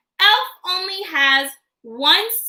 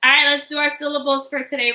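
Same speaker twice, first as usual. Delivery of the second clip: normal talking voice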